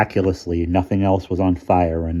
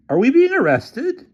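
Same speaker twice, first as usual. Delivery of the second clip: high, baffled demand; can't believe what's happening; bewildered demand; 7 dB louder than usual. A narrator's reading. Developer asking the officer, bewildered and high. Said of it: "demanded the developer in bewilderment".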